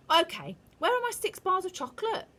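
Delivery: put-on high, loud bratty boy voice; whiny schoolboy wanting his prize; demanding boy. A narrator's reading. Bruno demanding chocolate from the witch, high and bratty.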